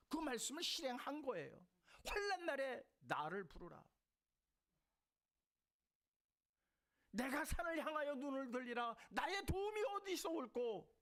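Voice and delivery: high, loud, ringing sermon cry; full-force preaching. Acted. Pastor to the congregation, raising the voice in fervent preaching. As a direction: preach it out to the whole hall.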